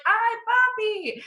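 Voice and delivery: high slow breathy "Ay, papi"; mock Spanish lover moan; sultry Spanish moan. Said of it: "speaking Spanish, like".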